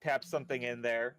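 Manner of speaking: normal talking voice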